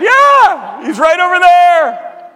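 high, loud slowed insistence; loud eager confirmation; excited shout. Retold or imitated. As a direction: shout it so he believes you. Insisting the author is nearby.

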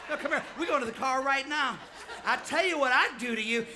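loud, threatening imitation of an angry father; acted-out dad working himself up; worked-up dad. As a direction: order the kid along, loudly. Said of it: "he'd get himself so worked up".

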